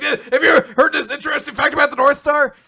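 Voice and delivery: high, loud nervous blurt through laughter; adorably awkward. Retold or imitated. Shy character breaking the ice with a star fact, hammed-up awkward. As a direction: grab at any topic, mock-flustered. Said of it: "they're both adorably awkward".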